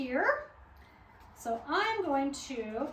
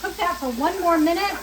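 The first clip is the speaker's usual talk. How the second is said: normal talking voice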